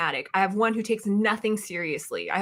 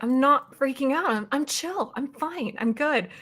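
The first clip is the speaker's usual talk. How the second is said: insisting I'm fine; 7 semitones higher than usual. Retold or imitated. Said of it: "I was like".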